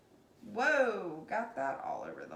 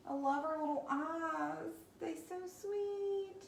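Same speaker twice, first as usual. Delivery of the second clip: cooing; 5 semitones higher than usual. Real, not acted. Cooing over the painted face.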